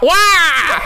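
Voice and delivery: mock scream as Waluigi is sucked into the pipe. Storyteller voicing Waluigi's cry in a fan fiction reading, hammed-up high yelp. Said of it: "screamed out".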